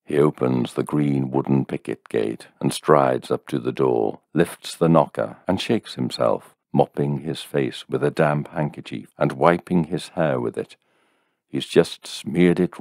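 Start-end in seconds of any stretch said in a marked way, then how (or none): none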